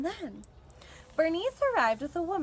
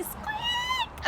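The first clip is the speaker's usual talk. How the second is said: very high squeaky squeal; squeak. A narrator's reading. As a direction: make the loudspeaker squeal. Loudspeaker squeal.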